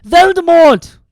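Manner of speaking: Harry bellows the name at Hagrid. Acted. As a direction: roar the name. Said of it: "Harry bellowed".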